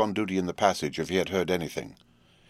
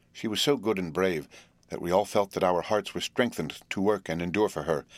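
plain reading voice

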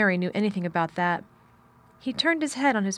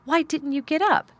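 higher-pitched stiff, accusing question; stiff demand; 8 semitones higher, 3 dB louder than usual. A narrator's reading. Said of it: "with her stiff manner".

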